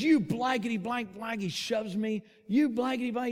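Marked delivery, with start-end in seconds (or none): 0.0-1.4 mildly heated, raised mock cursing; higher-pitched stand-in swearing, retold; cursing at someone. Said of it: "he comes up and he says". 2.5-3.3 raised, higher retold cursing; mock cussing. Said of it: "He's cussing me".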